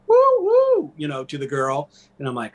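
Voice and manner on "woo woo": much higher whooping street catcall. Retold or imitated. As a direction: whoop it like a guy catcalling a woman. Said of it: "makes some cat call".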